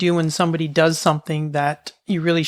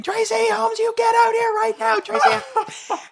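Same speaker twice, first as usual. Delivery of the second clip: imitated mom's yell; slower than usual. Mom stuck outside orders child out, imitated high yell. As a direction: yell the full name in a high mock voice. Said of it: "she's still yelling at me".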